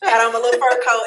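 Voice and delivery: ordinary talk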